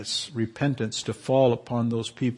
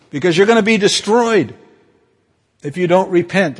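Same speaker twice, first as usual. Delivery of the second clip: raised, louder prophetic warning; 7 semitones higher, 9 dB louder than usual. Retold or imitated. Jonah to the city, loud raised warning. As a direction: threaten doom from on high.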